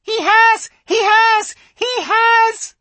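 put-on very high, loud squeaky baby squirrel; tiny squirrel shrieking; squeaking. A narrator's reading. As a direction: shriek it in panic. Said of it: "it squeaked".

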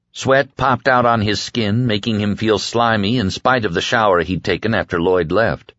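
plain reading voice

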